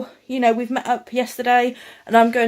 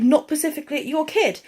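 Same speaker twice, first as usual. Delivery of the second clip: usual speaking voice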